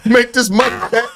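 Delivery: put-on high-pitched comic Nigerian accent; mock Nigerian accent. Host mocks the father urging his daughter on, comic Nigerian accent. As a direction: imitate a Nigerian dad, pitched well up. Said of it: "don't with the Nigerian accent".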